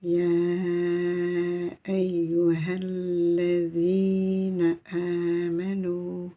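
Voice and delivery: long drawn-out chanted Arabic; slow Quranic recitation. Shown as a demonstration. Teacher to students, chanting the full verse slowly. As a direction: recite the whole phrase slowly, every vowel held. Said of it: "reciting the whole phrase".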